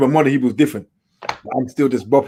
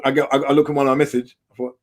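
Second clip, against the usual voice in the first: ordinary talk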